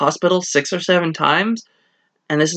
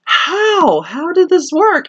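baffled disbelief; 13 semitones higher, 3 dB louder, slower than usual. Retold or imitated. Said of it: "And it's like".

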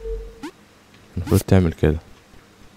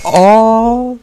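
high, loud sudden "aah"; startled cry; 12 semitones higher, 6 dB louder, much slower than usual. Real, not acted. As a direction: yelp as the character falls. (صرخة مفاجأة عالية)

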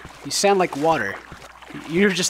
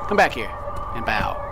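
dark lord; 14 semitones lower, 5 dB quieter than usual. Pretend. Commanding creature to bow.

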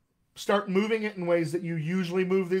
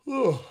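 drawn-out yawning oh; 7 semitones higher than usual. Shown as a demonstration. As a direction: let out a big open yawn. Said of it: "did I make you yawn".

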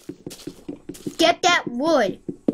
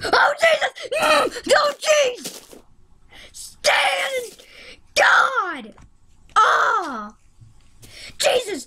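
high panicked shrieks; terrified horror victim; 8 semitones higher, much slower than usual. Real, not acted. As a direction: shriek in sudden fright. Jump scare in game.

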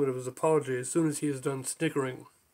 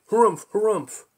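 pompous harumph; 6 semitones higher, 8 dB louder than usual. Acted. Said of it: "chimed in".

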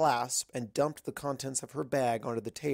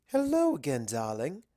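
Rarity's voice; 3 semitones higher than usual. Acted. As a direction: sweep in with a lofty, sing-song hello. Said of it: "said Rarity as she walked".

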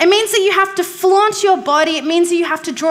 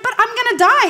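put-on child voice; 7 semitones higher, faster than usual. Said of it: "I'm like".

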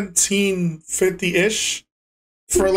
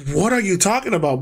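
ordinary talk

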